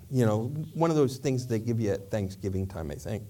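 ordinary talk

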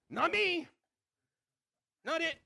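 not me, shouted; high, loud child's cry. Retold or imitated. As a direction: dodge being it. Kids avoiding being it.

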